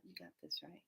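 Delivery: tiny low reassurance under the breath; hushed reply. Retold or imitated. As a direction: answer in a deep, very soft murmur. Answering voice from the heavens.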